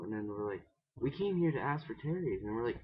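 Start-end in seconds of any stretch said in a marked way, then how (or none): 1.0-2.4 high, loud exclaimed reminder; retold sudden realization. Confused friends remember why they came to the house, exclaiming. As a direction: act out remembering the forgotten errand, pitched up.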